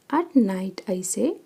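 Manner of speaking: ordinary talk, nothing marked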